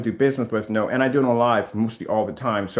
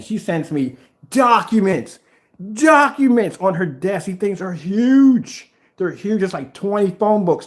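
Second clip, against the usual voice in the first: louder, higher, slow amazement; exaggerated awe; 9 semitones higher, 5 dB louder, slower than usual. Real, not acted. Describing the lawyer friend's huge documents, slow and amazed. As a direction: stretch out how huge the pile is.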